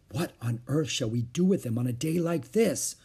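ordinary delivery, nothing marked

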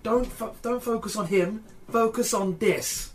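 high, urgent in-character order; telling her off. Acted. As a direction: urge a fighter to switch targets. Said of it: "telling her to stop".

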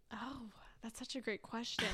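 breathy whisper, almost no voice. Real, not acted. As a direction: whisper it, like buying time. Stalling before answering a question.

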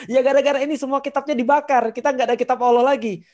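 high, loud fretful cry over the lost book; anxious Uzair. Retold or imitated. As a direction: sound upset that it is all gone. Uzair voiced, worried about burned books.

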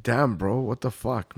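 normal talking voice

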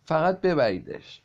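high, loud, stretched-out demand; shout. A narrator's reading. Michael demands they take the animal away, loud shout. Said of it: "مایکل فریاد زد".

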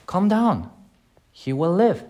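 high calming voice. Retold or imitated. Jesus answers grieving Martha.

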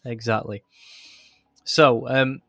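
ordinary talk

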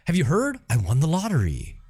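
high-pitched mock glad tidings; good-news announcement. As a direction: imitate someone bursting with good news. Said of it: "It didn't have to be bad news".

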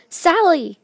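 loud, high holler of a name; calling out. Acted. Calling Sally from across the field, shouted.

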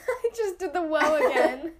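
words breaking into real laughter; high, loud giggly confession; laughing. Real, not acted. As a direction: crack up while talking. Cracks up mid-question.

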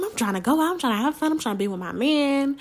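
mock whining about wanting to go out; whiny complaint. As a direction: complain to yourself about being stuck with mom. Retold frustrated inner complaint.

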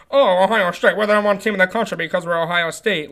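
lightly voiced smug Ohio State fan, tossed off; mocking a blinkered fan. Mocking the fans who think Ohio State is number one, in a lightly sneering voice. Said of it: "only put on Ohio State blinders and think".

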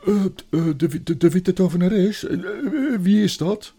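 loud, high stammering question; flustered boy. Acted. Edmund hears of the witch, loud flustered stammer. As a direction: stammer and splutter, high and alarmed.

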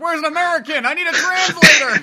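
high, loud hammed-up tourist panic; mock panicked tourist; clueless American tourist. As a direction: play a lost loud American.

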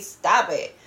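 ordinary talk

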